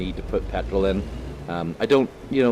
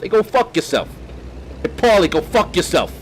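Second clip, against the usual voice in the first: high, loud mock mobster snarl; Goodfellas gangster; 12 semitones higher, 6 dB louder than usual. Telling Paulie off like a Goodfellas mobster, loud hammed-up gangster voice. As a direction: bark it like a wiseguy telling off Paulie. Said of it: "it sounds like I could be in Goodfellas".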